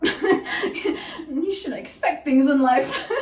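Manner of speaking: words breaking up into laughter; laughing. Real, not acted. Storyteller cracking up at her own retold comeback, laughing. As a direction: laugh through the words, drawn out slowly.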